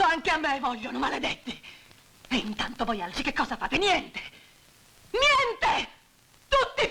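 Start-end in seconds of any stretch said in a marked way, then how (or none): 3.8-4.2 Niente! flung out in anger, a little raised; a sharp angry outburst on Niente!; angry cry. Acted. Widow scolding the idle onlookers, angry. 5.1-6.9 Niente! screamed much higher and louder; furious shout. Acted. Widow screaming at the men who did nothing, furious.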